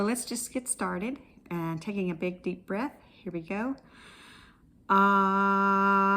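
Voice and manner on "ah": long held loud ah sound; sustained ah. Shown as a demonstration. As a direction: hold a big steady ahh. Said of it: "do an ah sound".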